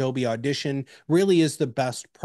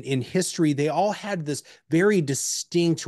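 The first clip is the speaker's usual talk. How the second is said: usual speaking voice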